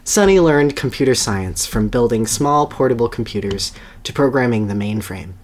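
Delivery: ordinary delivery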